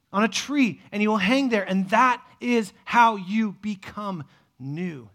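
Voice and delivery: loud, slow, emphatic preaching; full-force preaching. Acted. Preaching the cross.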